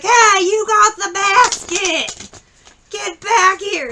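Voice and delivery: high, loud, slow holler across a store; mock enraged husband calling out; imitated angry shout. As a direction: yell after someone escaping, hammed-up fury. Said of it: "pissed off".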